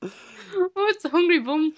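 high, loud hammed-up Irish lilt; over-the-top fake Irish accent; crap Irish accent. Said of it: "put on our crap Irish accents".

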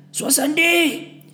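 high yelled count; group shout. A narrator's reading. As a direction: shout it out as a squad. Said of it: "we shouted in unison".

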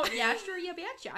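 high, loud, hammed-up Minnesotan; laughing mock-Minnesota twang; Minnesota accent. Shown as a demonstration. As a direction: lay the Minnesota accent on thick. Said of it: "I could up the Minnesota game".

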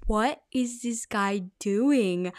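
slow, drawn-out retold disbelief; baffled inner voice, half-amused; incredulous. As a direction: stretch out each word in mock bewilderment. Retelling her disbelief at stranger.

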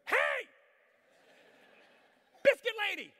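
loud, high call across the plane; shouting out. Retold or imitated. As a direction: yell to catch her eye. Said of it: "I go".

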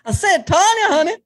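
high, sing-song sweetie call; teasing honey call. Pretend. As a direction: coo at Tanya with mock sweetness.

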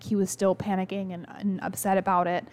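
ordinary talk, nothing marked

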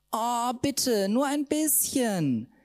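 high, slow begging; high drawn-out plea; pleading. Acted. Ida begging.

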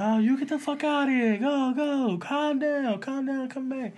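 high, hammed-up yell at the cook; mock yelling restaurant owners. Restaurant owners yelling at the cook, high mock yell. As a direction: squeal it like panicked Jersey owners. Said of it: "And then they're like".

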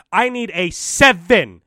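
high, loud, slow mock old-lady demand. Old lady customer demanding of a salesman, loud caricature. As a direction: blare it slowly in a put-on old woman's voice. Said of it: "this is how they will ask ... I had this old lady come in".

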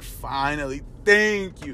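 high, exaggerated thank-you; sarcastic, overdone relief; mock relief. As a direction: sigh out fake gratitude, hammed up.

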